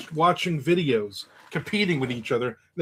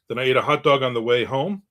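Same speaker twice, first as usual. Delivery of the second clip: ordinary delivery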